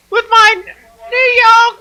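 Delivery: very high, loud, slow mock New York British accent. Announcing phone calls, silly New York British accent. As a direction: stretch out a squealed, badly faked New York British accent. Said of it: "British accent".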